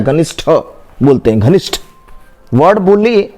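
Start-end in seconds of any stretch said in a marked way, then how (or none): 0.0-1.8 घनिष्ठ ending in an aspirated ठ; correct pronunciation. Shown as a demonstration. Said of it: "शुद्ध हमारा ठ है". (घनिष्ठ का सही उच्चारण, अंत में ठ)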